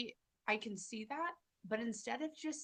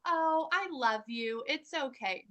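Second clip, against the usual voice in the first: higher, louder, slow doormat sweetness; mock pushover gushing; 5 semitones higher, 11 dB louder than usual. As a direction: play the pushover who forgives everything. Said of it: "I don't go".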